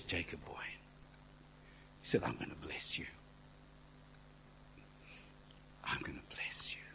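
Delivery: hushed, low voice of God; soft, nearly whispered, with long pauses; gentle blessing. Retold or imitated. God tenderly promising to bless Jacob, hushed.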